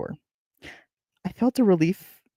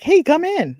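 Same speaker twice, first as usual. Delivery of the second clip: high, loud mock invitation; eager welcome; 13 semitones higher, 7 dB louder, slower than usual. Reader imitating hosts welcoming strangers, hammed-up eagerness. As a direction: call out a hammed-up cheery welcome.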